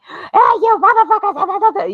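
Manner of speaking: very high, drawn-out mock scream; mock yelling and screaming. Vlogger imitating him yelling into the camera, mock screaming. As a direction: scream it like a hammed-up camera rant. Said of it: "yelling and screaming".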